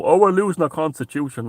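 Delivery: mock worried. Mocking listeners worried about the constitution, mock fretting. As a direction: whine it as a mocked worrier. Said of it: "And there you are worried".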